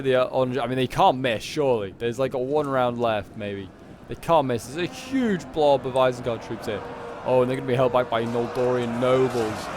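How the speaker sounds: ordinary talk, nothing marked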